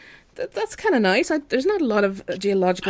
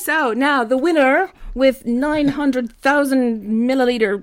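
game show host; 7 semitones higher, 8 dB louder, much slower than usual. Acted. Announcing the quiz winner.

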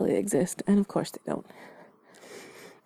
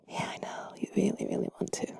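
very soft, near-whispered; barely voiced bedside murmur; hushed coaxing; 11 dB quieter than usual. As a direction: coax them softly towards sleep. Coaxing the listener toward sleep.

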